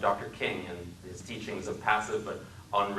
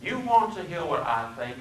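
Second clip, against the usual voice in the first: put-on threatening Southern accent; 8 semitones higher than usual. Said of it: "His speech was the deepest South".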